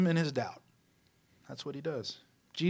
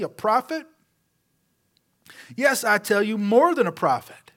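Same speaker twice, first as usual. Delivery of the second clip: raised, pressing questions to the crowd; Jesus defending John before the crowds, heated and raised; heated rhetorical challenge; 4 semitones higher, 4 dB louder than usual. Acted.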